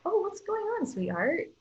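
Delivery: high, gentle coo on the oh; tender concern. Retold or imitated. A parent soothes a crying toddler with tender concern.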